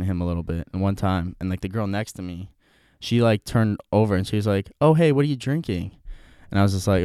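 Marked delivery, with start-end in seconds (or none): 4.8-5.9 higher-pitched, chatty question; friendly opener. Retold or imitated. Girl next to me at the bar, imitated friendly opener. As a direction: imitate a friendly stranger breaking the ice.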